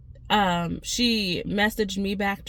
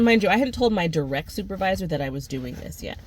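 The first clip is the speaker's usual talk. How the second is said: usual speaking voice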